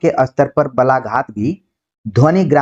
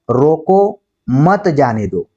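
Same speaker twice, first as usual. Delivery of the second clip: drawn-out रोको, then मत जाने दो run together; stress and grouping; much slower than usual. Shown as a demonstration. Teacher reading the other sentence, slow, break after रोको. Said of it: "ये अब बलाघात के ऊपर निर्भर करता".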